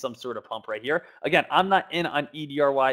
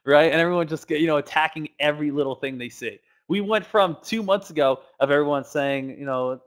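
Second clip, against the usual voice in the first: ordinary talk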